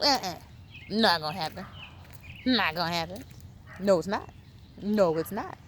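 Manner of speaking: ordinary delivery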